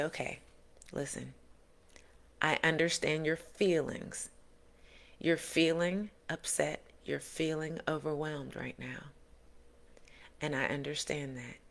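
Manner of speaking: put-on calm parent soothing a child. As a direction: gently comfort a child pulled aside.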